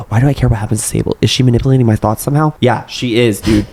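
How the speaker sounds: usual speaking voice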